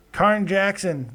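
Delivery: normal talking voice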